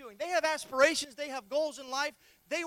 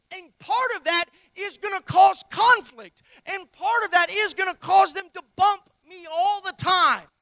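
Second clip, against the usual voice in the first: preaching at full force; 3 semitones higher, 10 dB louder than usual. Acted. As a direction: drive it home, slowly.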